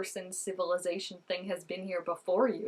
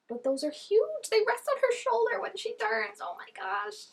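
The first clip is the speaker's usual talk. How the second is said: amazed; 13 semitones higher, 5 dB louder than usual. Real, not acted. Reacting to the girl's earrings, higher and louder amazement. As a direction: marvel at how huge they are.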